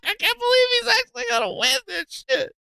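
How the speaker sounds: high, helpless laughing through the words; crying with laughter. Real, not acted. Streamer laughing at the clip in a high cracked voice. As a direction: laugh so hard it squeaks.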